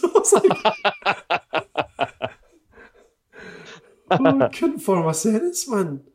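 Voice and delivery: giggling helplessly at his own story; laughing. Real, not acted. Telling his friend about the medal mix-up, laughing through it. Laughing while recounting being starstruck.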